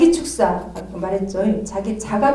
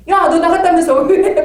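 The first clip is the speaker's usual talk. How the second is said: much higher, louder laughing challenge; laughing rebuke; 8 semitones higher, 9 dB louder than usual. Retold or imitated. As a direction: catch the spirit out. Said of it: "막 이렇게 하거든요".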